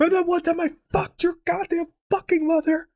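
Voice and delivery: spring it on them up high; sudden outburst. Acted.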